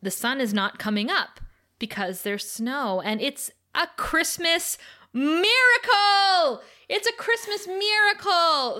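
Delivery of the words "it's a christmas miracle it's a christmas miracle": high, loud, drawn-out hammed-up wonder; gushing exclamation. Recapper announcing Sunnydale's sudden snow, hammed-up high exclamation. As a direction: belt out the big miracle, milking it. Mock-triumphant announcement of snowfall miracle.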